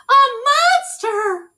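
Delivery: high, loud, slow scream of fright; terrified shout. Acted. Baby spotting the pig at her window, screaming in fright. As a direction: scream in terror. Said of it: "shouted Baby".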